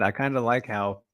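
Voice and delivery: normal talking voice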